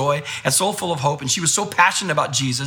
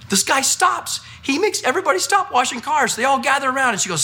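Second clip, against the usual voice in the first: ordinary talk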